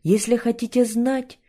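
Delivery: loud, high angry retort; angry outburst. A narrator's reading. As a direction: flare up at the visitor. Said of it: "то теперь вспылил".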